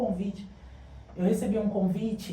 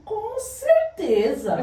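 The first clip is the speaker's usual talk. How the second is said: laughing yes; 13 semitones higher, 6 dB louder, much slower than usual. Retold or imitated. Accepting the invitation.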